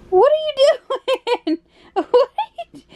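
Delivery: high pitched, breaking into laughter; laughing. Real, not acted. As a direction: mom laughing at her silly son, high and giggly. Toddler being silly.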